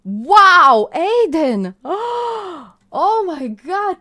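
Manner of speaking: ordinary talk, nothing marked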